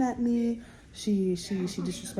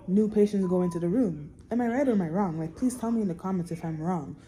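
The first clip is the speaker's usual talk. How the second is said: ordinary delivery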